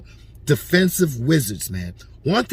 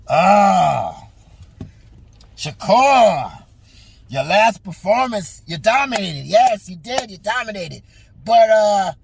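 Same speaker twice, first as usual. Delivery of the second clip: a hint of an old promoter, loud opening then easing off; impression of Bob Arum; 2 semitones higher, 4 dB louder, slower than usual. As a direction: voice Bob Arum sweet-talking his fighter. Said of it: "you know what Bob could say right now?".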